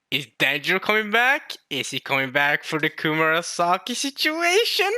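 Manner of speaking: high, animated gushing; amazed excitement. Real, not acted. Fan recounting how they guessed it, amazed and excited. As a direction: gush in high-pitched disbelief. Amazed by own correct deduction.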